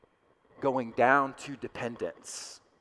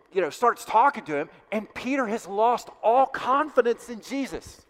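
higher, louder, driving sermon climax; preaching at full force; 7 semitones higher, 5 dB louder than usual. Acted. Pastor urging his congregation to feel Peter's loss, full-force preaching. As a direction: preach it hard, pressing each line home.

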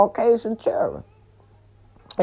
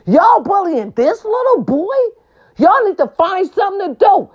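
angry dad yell; 11 semitones higher than usual. Retold or imitated. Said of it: "Pop said".

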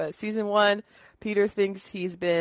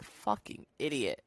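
soft, low, dry Lois put-down; 5 semitones lower, 9 dB quieter than usual. Retold or imitated. Doing Lois's line when calling Corey an idiot, softly. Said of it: "I try to say it like Lois".

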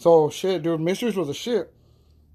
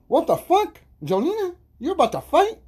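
much higher, stunned and incredulous; shocked disbelief; 11 semitones higher than usual. Retold or imitated. Seeing cousin Jolena about to fight, high stunned disbelief. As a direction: squeal it out in total disbelief. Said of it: "I was shocked".

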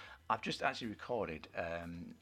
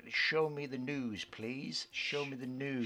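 mock demand; 6 semitones higher, slower than usual. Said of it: "asking for news on screen".

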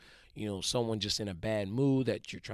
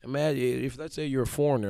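ordinary talk

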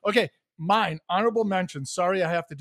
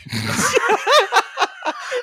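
high laugh; 4 dB louder than usual. Real, not acted. Laughing at a quote.

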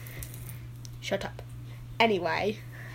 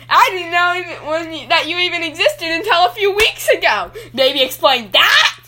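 loud, accusing outburst at father; angry shouting; 5 semitones higher, 9 dB louder than usual. Acted. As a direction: yell the grievances at him. Child confronting father angrily.

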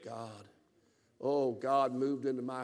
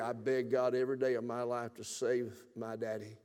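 normal talking voice

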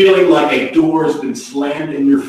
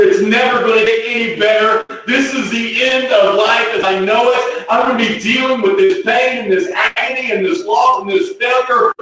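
higher-pitched, hammed-up outburst of despair; voiced despair; 6 semitones higher than usual. Preacher voices someone choosing despair on Saturday, despairing. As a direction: wail it like someone giving up for good. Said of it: "is we can despair".

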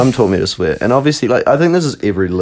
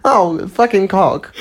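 mock high squeal; 11 semitones higher, much slower than usual. Mocking Adam's high version, laughing.

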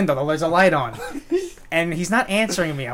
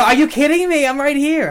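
high, loud, laughing retold yell; mock outraged yell; 9 semitones higher, 7 dB louder than usual. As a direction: shout it at a friend who bails, half laughing. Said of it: "I go".

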